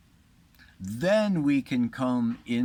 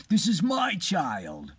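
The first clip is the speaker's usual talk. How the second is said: higher, slower, insisting; second woman counters, higher and slower; second mother's claim; 6 semitones higher than usual. Retold or imitated. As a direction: stretch out the claim, pitched up. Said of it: "the other one goes".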